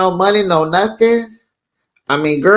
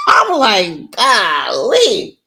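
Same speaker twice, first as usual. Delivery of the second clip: laughing; 6 semitones higher, much slower than usual. Real, not acted. Laughing reaction.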